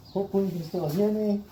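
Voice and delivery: muffled through a respirator; through a face mask. Real, not acted. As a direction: talk muffled behind a dust mask. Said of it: "I'm gonna start talking funny because I'm wearing a respirator".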